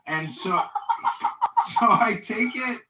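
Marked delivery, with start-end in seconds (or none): 0.6-1.7 short breathy burst of laughter. Real, not acted. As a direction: laughs at his own story. Laughing mid story.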